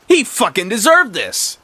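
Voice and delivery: high, swooping angry pitch; acted spiteful outburst; vindictive. A narrator's reading. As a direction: spit it out high and unrepentant. Martha justifies killing him.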